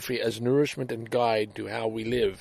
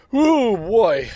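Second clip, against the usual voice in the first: a burst of real excitement, louder and higher; excited outburst; 8 semitones higher, 7 dB louder than usual. Real, not acted. Vlogger catching himself getting excited about his drive-thru story, excited. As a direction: blurt it out, too keyed up to hold back. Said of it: "I'm a little excited".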